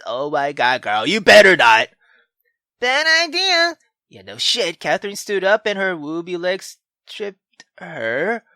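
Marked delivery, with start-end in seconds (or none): none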